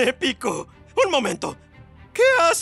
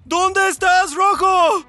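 calling out; 6 semitones higher, 5 dB louder, slower than usual. Acted. Calling out for missing friend.

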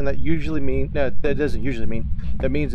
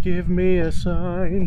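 slow, higher, coaxing call; drawn-out plea; 5 semitones higher, much slower than usual. Real, not acted. Calling to the ghost for a sign, drawn out. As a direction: stretch it out to lure the ghost.

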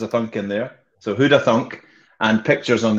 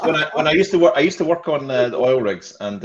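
ordinary talk

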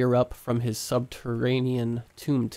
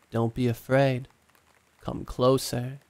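ordinary delivery, nothing marked

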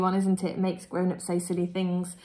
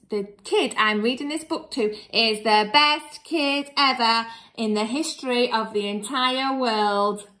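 plain reading voice

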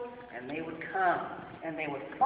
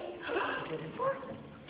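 mock gasp; 11 semitones higher, 5 dB quieter than usual. Gasp at missing sheep.

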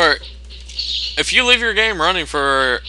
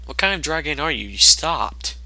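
ordinary talk

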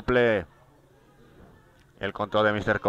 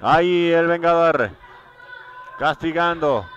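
higher, louder hype call; excited play-by-play; 6 semitones higher, 6 dB louder than usual. Real, not acted. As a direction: call the armbar all fired up. Armbar called live, rising excitement. (narración emocionada)

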